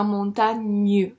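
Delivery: "montagne" slow and loud, ending on gneu; stressed gne sound. Shown as a demonstration. Teacher recalling a sound, "montagne" with drawn-out gne. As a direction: split off the gne at the end of "montagne". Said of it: "elles forment le son gne".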